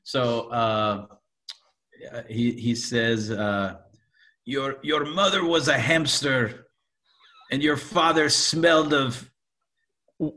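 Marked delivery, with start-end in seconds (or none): 4.5-10.3 put-on hesitant, higher French-accented taunt; tentative mock-French insult, rising at the end. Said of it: "say it in the French accent".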